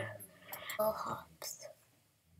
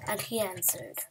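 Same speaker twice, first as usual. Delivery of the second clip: usual speaking voice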